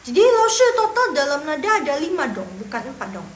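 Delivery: lightly voiced student; mock student question. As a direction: play a student catching the teacher out. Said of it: "imagined student asking teacher".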